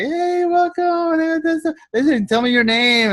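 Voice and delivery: very high and slow greeting; mock cheerful welcome; welcoming upline. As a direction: greet them like a cheerful host. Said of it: "they're gonna be like".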